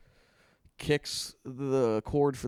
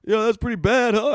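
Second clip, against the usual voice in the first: laughing at himself; 9 semitones higher, 9 dB louder than usual. Retold or imitated. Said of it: "and I was like".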